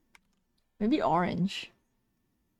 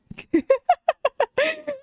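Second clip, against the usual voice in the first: high-pitched real giggling fit; delighted laugh; 4 dB louder than usual. Real, not acted. Streamer made so happy by the piece, delighted laugh.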